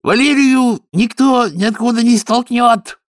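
high, loud, slow bark; a loud, drawn-out snap. Acted. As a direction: bark it out as a furious Betty. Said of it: "рявкнула Бетти".

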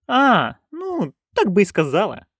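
high-pitched acknowledgement; nanny catching on. A narrator's reading. Nanny accepting friend's explanation, catching on. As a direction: answer in a high character voice. Nanny replies, finally understanding.

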